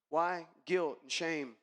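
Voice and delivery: ordinary delivery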